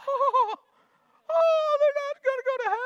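very high, loud mock-relieved squeal; excited parent voice. Parent imitating their own relief, high excited squeal.

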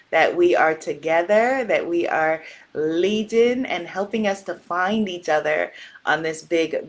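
soft, low prayer voice; opening prayer. Real, not acted. Host leading her goddess community in prayer, soft and reverent.

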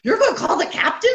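high, quick incredulous question; mock disbelief. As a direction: imitate an aghast colleague. Acted colleague's disbelief.

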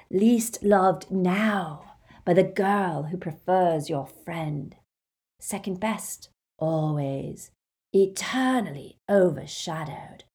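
slow eerie whisper; sinister whisper. Acted. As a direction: taunt him in a whisper. Horcrux taunting Ron.

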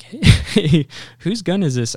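high, loud call across the pond. Retold or imitated. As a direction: shout to find the owner. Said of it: "was like".

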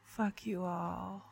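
quiet mumble. Pretend. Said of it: "talk like this".

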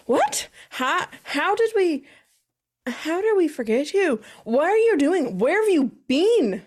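high, startled calls of what and where; loud, surprised. Acted. Darcy calling upstairs, shocked at forgetting her friend. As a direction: call out in shock, pitch jumping up. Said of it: "saying this really loudly".

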